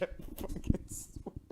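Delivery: slow exasperated whisper through a laugh; whispered aside. Real, not acted. Reviewer cursing Gengar under his breath, whispered. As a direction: hush it like a private curse.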